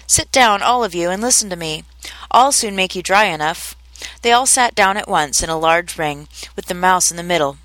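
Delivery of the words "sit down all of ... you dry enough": plain reading voice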